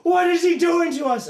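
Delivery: very high, loud wail of a question; horrified scream. Acted. Knight growing fur inside the spell, horrified scream.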